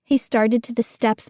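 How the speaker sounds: ordinary delivery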